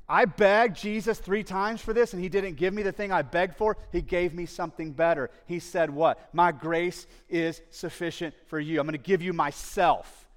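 loud, high preacher's declaration; fervent preaching. Acted. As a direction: preach it with rising fervour. Preacher acts Paul's testimony.

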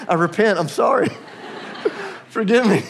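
laughing half-joking apology; mock repentance. To his friend, a laughing mock apology. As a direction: pretend to grovel, laughing. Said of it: "And I'm like".

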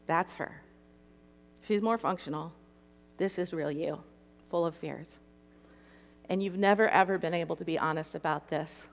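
low, soft, measured voice; gentle revelation. Retold or imitated. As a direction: speak quietly as Jesus showing her the truth. Voices Jesus gently showing her.

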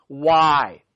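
low, drawn-out cry of why; anguished why. Acted. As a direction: ask it from deep pain. Job's repeated why.

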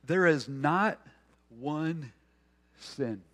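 "There is not one sin" with a pause before "one" and before "sin"; pausing for reflection. Shown as a demonstration. Preacher invites us to think, "one sin" spaced out and hushed. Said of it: "invites us to just pause and think about this".

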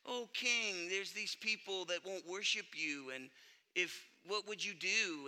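low, hushed scheming to a king; sneaky little rat. Retold or imitated. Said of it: "one of them little rats ... he sneaks into the king".

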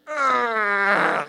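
long high whiny groan; drawn-out "ugh" of frustration; exasperated groan. Acted. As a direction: groan high, torn over giving in. Torn reaction to Maria's insistence.

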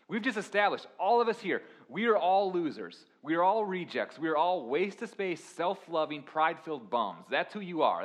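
rally the congregation with fire; full-force preaching. Acted. Preacher drives the point home to the church, full force.

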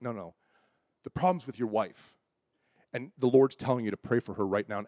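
soft, low, gentle insistence; sweetly and quietly correct him; nice Bob Jones. Retold or imitated. Prophet gently telling husband to pray for wife, kindly. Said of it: "He's so sweet".

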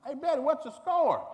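high, loud call across the stands; a loud call to the church women behind the backstop. Retold or imitated. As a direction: hail Betty from the bench. Calling out again.

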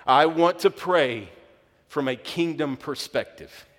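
loud, high, emphatic declaration; worked up. Real, not acted. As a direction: proclaim it slowly and forcefully, then ease off. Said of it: "I'm getting worked up".

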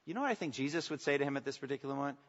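ordinary delivery

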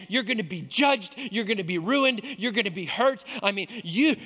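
Satan's accusing voice. Retold or imitated. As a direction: hammer him with blame. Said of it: "the voice of accusation that says this".

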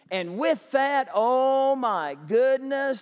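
ordinary delivery

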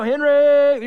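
long, loud, high call; hollered name. Acted. God calling a straggler like dad, hollering. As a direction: bellow a child's name across the street.

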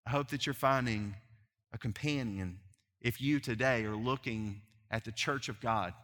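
usual speaking voice

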